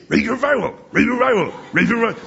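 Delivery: put-on old professor, growing angrier, hammering the same answer; imitating Doctor Ebling. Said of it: "He would just say".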